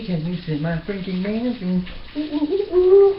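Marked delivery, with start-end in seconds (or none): 2.2-3.2 high delighted coos; delighted cooing. Real, not acted. Cooing with delight over her pork chop. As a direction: coo over the food.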